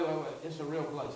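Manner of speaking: ordinary delivery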